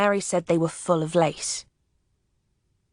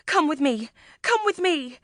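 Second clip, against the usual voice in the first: higher, pleading lady's voice, climbing on the repeat; urgent plea; 9 semitones higher than usual. Acted. The memsahib pulling the officer into the house, higher and urgent. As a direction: hurry him along in panic.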